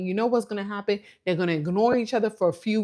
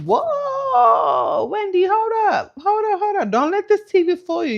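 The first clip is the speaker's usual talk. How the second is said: mock alarm, stopping someone in their tracks; alarmed warning; 13 semitones higher, 6 dB louder than usual. Viewer warning Wendy to back off, high alarmed shout.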